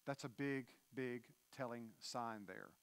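ordinary delivery

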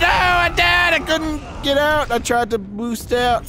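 high dismayed outcry; dismayed cry. Real, not acted. Gamer dying in the fight, high dismayed outcry. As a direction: cry out as you die.

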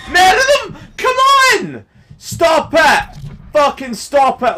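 very high-pitched, loud outburst; losing patience at the top of the voice; exasperated shouting. Real, not acted. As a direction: yell in real exasperation at the game.

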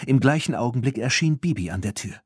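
ordinary delivery, nothing marked

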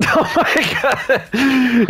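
high, laughing through the words; cracking up while talking. Real, not acted. Laughing at teammate.

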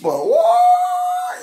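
very high, loud shout of thrill; excited whoa. Real, not acted. Showing off his goosebumps.

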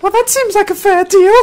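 put-on squeaky high girl voice with a laugh; high girly voice. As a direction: pitch way up like a young girl.

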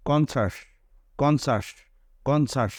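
কনসাস with a শ-like sound. Shown as a demonstration. Teacher drilling the word for students, clearly. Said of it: "সি এর উচ্চারণটা হবে".

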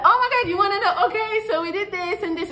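high, fast gushing girl voice, imitating her; excited girlfriend. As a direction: gush it out eagerly like she can't wait to tell. Girlfriend happily sharing her weekend.

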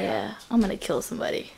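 creepy killer voice. Acted. Kids acting out a killer.

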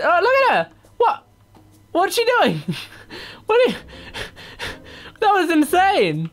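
high-pitched laughing disbelief; amazed. Real, not acted. Gamer watching another dancer's insane move, high amazed squeal.

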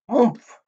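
loud, high oomph of a landing; a pig landing with a thump, grunting. Acted. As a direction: grunt as you hit the ground. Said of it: "he grunted".

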